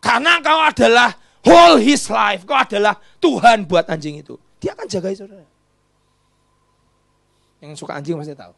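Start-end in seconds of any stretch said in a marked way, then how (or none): 0.0-5.5 loud, high climax; full-force preaching cry; preaching shout. Acted. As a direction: shout it to the back row.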